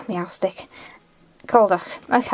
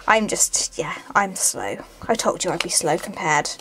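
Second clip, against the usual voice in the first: unvoiced whispered aside, slowing down; 4 semitones lower, 6 dB quieter than usual. Real, not acted. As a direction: breathe it out like a sheepish confession. Self-deprecating aside to viewers.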